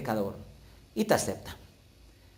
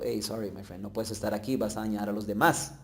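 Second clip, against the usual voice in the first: softer, offhand brush-off; polite dismissal; 4 semitones lower, 6 dB quieter than usual. Retold or imitated. Community tells the unrepentant sinner to leave, a softer, offhand dismissal. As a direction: ease them out the door, quietly.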